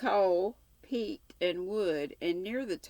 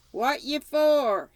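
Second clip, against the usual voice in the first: loud, high, slow shout from a barn window; 10 semitones higher, 10 dB louder, slower than usual. Acted. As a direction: bellow it out at the stranger. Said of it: "he shouted".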